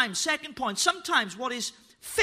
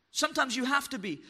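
ordinary delivery

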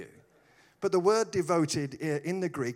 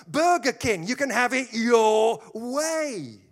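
loud, high slogan with drawn-out ending; booming slogan; 8 semitones higher, 9 dB louder, slower than usual. Acted. Preacher quoting a burger slogan to the congregation, booming. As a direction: belt it out like a big advert.

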